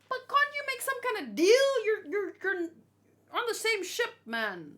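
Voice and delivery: exasperated plea. Real, not acted. Reacting to Blackbeard killing his crewmate, exasperated high plea. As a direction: cry out at the character, can't believe it.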